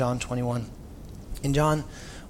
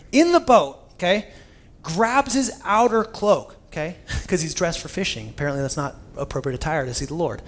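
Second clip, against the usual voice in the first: usual speaking voice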